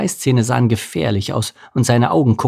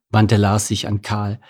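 ordinary delivery, nothing marked

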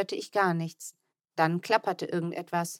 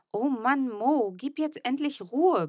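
yelling at brother; 6 semitones higher than usual. A narrator's reading. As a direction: snap at the noisy brother. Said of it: "brüllte ich ihn an".

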